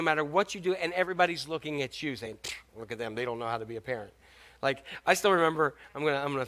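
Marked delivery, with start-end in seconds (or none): 2.4-4.1 low, soft scornful aside; muttered judgment. Acted. Onlookers watching a parent in a store, muttered judgment. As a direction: judge them under your breath.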